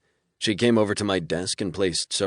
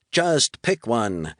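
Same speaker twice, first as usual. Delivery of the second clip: mocking imitation; 5 semitones higher, 3 dB louder, much slower than usual. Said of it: "Did you hear him".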